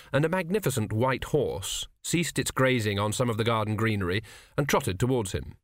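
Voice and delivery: plain reading voice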